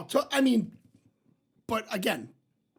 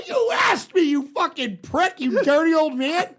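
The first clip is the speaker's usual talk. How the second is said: much higher, louder teasing insult; mock angry yell; 6 semitones higher, 5 dB louder, slower than usual. Yelling at the old man who asked for videos, mock angry. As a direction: yell it, slower, laughing at him. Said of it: "I go".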